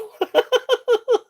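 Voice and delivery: very high, squeaky giggling; real laughing fit. Real, not acted. Seller laughing about her day with viewers, high giggles. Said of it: "I'm gonna be laughing about this day".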